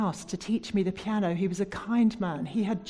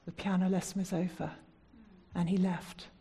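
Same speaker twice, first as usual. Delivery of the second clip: usual speaking voice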